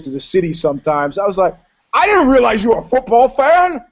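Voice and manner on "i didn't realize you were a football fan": higher, louder feigned naivety; mock innocent question. [name] teases the porn star he knows, higher and louder. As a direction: play the wide-eyed country mouse.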